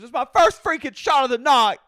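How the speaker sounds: very high, shouted mock protest; Brittany. Wife repeats her excuse to husband, shrieking mock protest. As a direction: yell it as a hammed-up wail. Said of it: "She goes".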